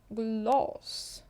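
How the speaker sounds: «Глас» repeated, A leaning to O; «Глас» said as glos, rising; «Глас» with a Swedish dark A; Swedish glas. Shown as a demonstration. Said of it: "Вимовляємо А, але більш наближено до О".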